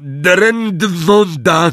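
high, slow spell cry; Neville's spell shout. A narrator's reading. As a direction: cast it with all he has. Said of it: "krzyknął ponownie Neville". (wysoki, wolny okrzyk zaklęcia)

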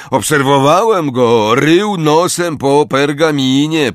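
high, loud jeering voice; mocking. A narrator's reading. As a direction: jeer for the onlookers. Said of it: "powiedział drwiąco Syriusz".

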